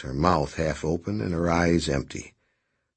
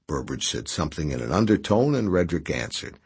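usual speaking voice

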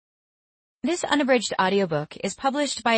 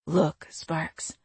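whisper; 3 semitones lower, 4 dB quieter than usual. Acted. West speaks privately at the door.

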